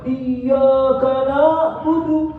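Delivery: high, slow Iyyaka na'budu with hamzah; mock wrong ain. Teacher mimicking students reading ain wrongly. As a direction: imitate the hamzah error.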